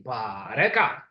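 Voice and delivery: "bareka" said with a rising tone. Shown as a demonstration. Teacher leading students in repeating bareka. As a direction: lead the class through "bareka" with a rise. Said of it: "Everybody say with me".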